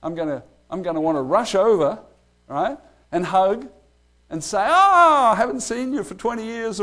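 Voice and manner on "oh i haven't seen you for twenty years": a sudden high, loud cry of delight; overjoyed reunion. Pretend. Greeting long-lost friends at the resurrection, sudden high delighted cry. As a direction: burst out like seeing an old friend again. Rushing to hug old friends.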